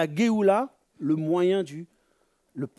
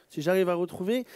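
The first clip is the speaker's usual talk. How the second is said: normal talking voice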